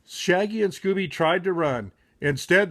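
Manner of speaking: usual speaking voice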